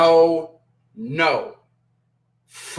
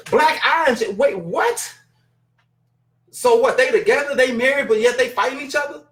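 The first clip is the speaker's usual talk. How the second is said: outraged disbelief; 6 semitones higher than usual. Real, not acted. Outraged at Max's comment.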